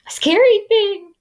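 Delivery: high frightened voice; scared squeak. Acted. Frightened prairie dog.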